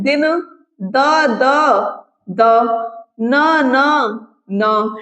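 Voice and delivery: दिन then द, द, न, न said slowly, letter by letter; sounding out letters. Shown as a demonstration. Teacher breaks words into sounds for students. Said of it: "word भी आ रहे हैं".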